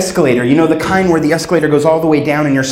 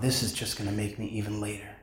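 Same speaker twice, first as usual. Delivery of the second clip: much softer, grumbling under his breath; grumbled thought; 6 semitones lower, 17 dB quieter than usual. Retold or imitated. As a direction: mutter it to yourself in frustration.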